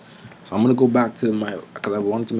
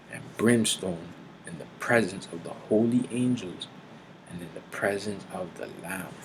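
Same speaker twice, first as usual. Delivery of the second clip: soft, grave Bible reading; solemn scripture; 6 dB quieter than usual. Acted. Preacher reading judgment to listeners, soft and grave. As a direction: read the judgment gravely, hushed.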